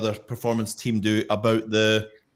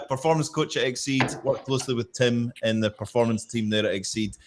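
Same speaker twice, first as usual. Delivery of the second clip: ordinary talk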